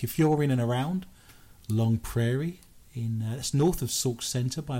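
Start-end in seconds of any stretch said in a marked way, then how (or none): none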